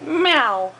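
playful imitated meow; drawn-out pretend meow; cat's meow. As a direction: show a toddler how a cat meows. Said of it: "What does a cat say?".

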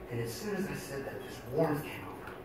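normal talking voice

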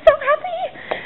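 overjoyed and gushing, voice jumping up; squealing with joy. Real, not acted. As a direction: rise into a thrilled squeal on the second so. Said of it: "I'm so, so, so happy".

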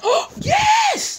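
high, cracking shout of joy; excited gasp. Real, not acted. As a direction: gasp then yell with delight. Friend also found diamonds.

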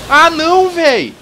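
loud high cry of dismay; dismayed shout. Real, not acted. Game disaster, player reacts.

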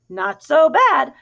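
drawn-out hen agreement; second hen agreeing. A narrator's reading. Second hen answers the first about the pigs' place, prim and slow. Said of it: "said the second to the first".

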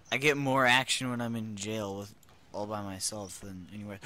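usual speaking voice

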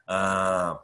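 ordinary talk

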